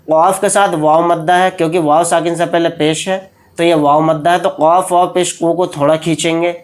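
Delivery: usual speaking voice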